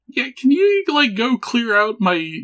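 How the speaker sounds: put-on high, slowed old lady plea. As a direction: quaver it up like a frail old woman asking a favour. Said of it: "if you meet an old lady in Lindenvale named Dolores".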